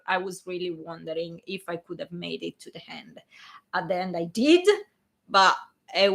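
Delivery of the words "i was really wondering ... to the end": hushed, breathy confession; whispering. Real, not acted. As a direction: drop to a whisper. Admitting how sick she was.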